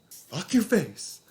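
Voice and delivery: high, slow, drawn-out curse; hammed-up indignant snap; mock outraged retort. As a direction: stretch the insult out in disbelief. Mocking retort to cop.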